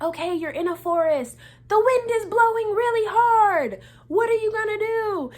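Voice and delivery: high, loud dramatic scene-setting; animated instructor. Retold or imitated. Audition leader sets up windy forest scenario, high animated shout.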